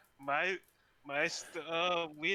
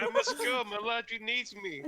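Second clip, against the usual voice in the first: high, laughing grand farewell; mock dramatic exit; 9 semitones higher, 4 dB louder than usual. Joking about leaving for laundry, hammed-up farewell. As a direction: play it like a melodrama.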